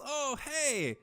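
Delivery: high, bright-eyed delighted call; excited oh hey. Acted. Caravan leader sees the light spell, excited. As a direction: jump up in pitch with delight.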